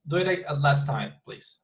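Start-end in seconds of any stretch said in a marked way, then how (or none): none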